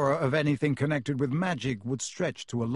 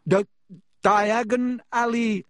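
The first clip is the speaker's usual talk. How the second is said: higher, louder, slow, choked on ash; coughing; 7 semitones higher, 6 dB louder than usual. A narrator's reading. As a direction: force the words out through a cough. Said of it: "he coughed".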